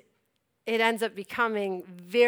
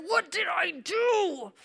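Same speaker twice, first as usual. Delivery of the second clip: slow, drawn-out, high-pitched bewilderment; why-me complaint, played up; mock dismay; 7 semitones higher, 5 dB quieter, slower than usual. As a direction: wail it in comic exasperation. Retold bewildered complaint to God.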